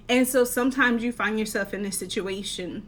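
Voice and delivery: usual speaking voice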